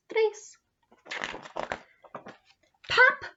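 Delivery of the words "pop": pop sound. Acted. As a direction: pop it out like a bursting button. Button pops off.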